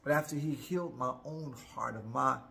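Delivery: ordinary talk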